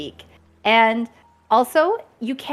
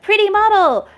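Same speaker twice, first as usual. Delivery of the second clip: gushing viewer; 11 semitones higher, 8 dB louder than usual. Retold or imitated.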